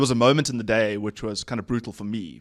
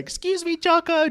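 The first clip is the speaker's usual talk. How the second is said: very high, squeaky put-on voice; timid squeaky imitation; 4 dB louder than usual. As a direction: mimic a meek interruption way up high. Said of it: "trying to calm him down".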